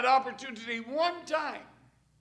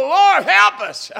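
high, loud plea for help; the wrong way, shouted; loud desperate cry; 9 semitones higher, 16 dB louder than usual. Shown as a demonstration. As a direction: cry it out at the top of the voice. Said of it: "I didn't say".

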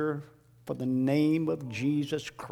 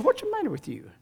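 wife's scolding voice; 12 semitones higher, faster than usual. Retold or imitated. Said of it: "she said".

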